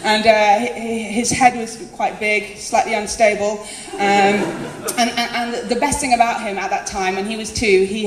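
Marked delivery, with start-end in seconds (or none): none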